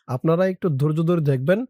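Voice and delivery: normal talking voice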